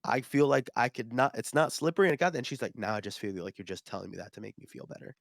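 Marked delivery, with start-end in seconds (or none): 2.8-5.2 low-key, soft, dejected murmur; unconvinced customer. Retold or imitated. Customer doubting the seller's reassurance, soft and dejected. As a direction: say it quietly, not buying the comfort. Sceptical disappointed customer voiced.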